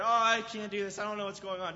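mock scared. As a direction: play the frightened climber, half-hearted. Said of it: "just kinda scared".

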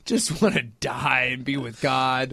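low, slow imitation of a gloomy father; mock weary dad. Father longing to go home to God, mock weary voice. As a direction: drag it out low in mock resignation.

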